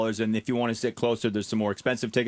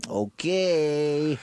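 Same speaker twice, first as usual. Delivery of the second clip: drawn-out, higher okay with a veiled threat; menacing undertone; 5 semitones higher than usual. Retold or imitated. Casino man ending the call, imitated menacing undertone. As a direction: stretch it out like an unspoken warning. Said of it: "You know, with that undertone".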